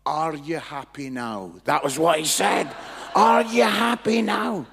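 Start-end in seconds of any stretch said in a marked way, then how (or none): 0.1-1.5 slow, low-pitched dismissive question; unimpressed retort. Retold or imitated. Guy answers the speaker's prophecy, slow unimpressed retort. Guy's unimpressed reply, first take. 3.1-4.7 slow, very loud, raised pitch; incredulous repeat. Retold or imitated. Speaker repeats the guy's reply to the crowd, loud disbelief.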